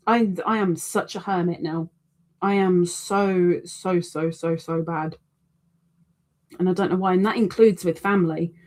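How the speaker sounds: ordinary delivery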